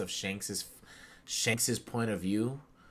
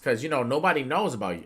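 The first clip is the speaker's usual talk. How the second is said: ordinary delivery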